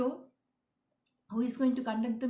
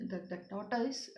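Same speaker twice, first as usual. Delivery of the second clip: ordinary talk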